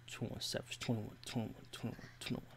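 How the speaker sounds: lightly imitated rapper catchphrase, soft. Streamer throws in a rap catchphrase, lightly imitating. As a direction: mutter a rapper's ad-lib, very softly.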